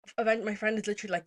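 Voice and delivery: usual speaking voice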